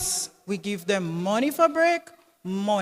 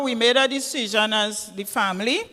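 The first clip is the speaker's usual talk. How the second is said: ordinary delivery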